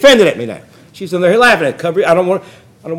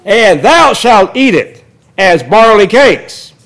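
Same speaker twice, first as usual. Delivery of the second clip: formal reading that gets louder toward the end; scripture reading, rising; 3 semitones higher, 4 dB louder than usual. Acted. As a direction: read it out, building toward the shock. Reads scripture aloud.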